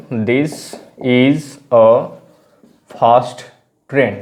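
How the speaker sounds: slow dictation with long gaps between words. Shown as a demonstration. Teacher giving learners an example sentence, slow word by word. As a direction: pause after each word as if writing it out.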